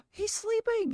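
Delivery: gasp, then melt over the sleeping pet; cooing at pet. Real, not acted. Cooing at Gizmo the pet who lies down, high baby talk.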